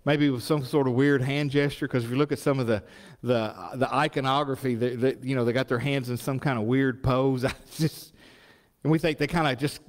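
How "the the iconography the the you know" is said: normal talking voice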